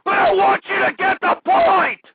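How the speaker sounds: sudden high, loud shout; exasperated yell. Real, not acted. Host desperate for listeners to get the point, yelling. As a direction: yell it at the top of your voice.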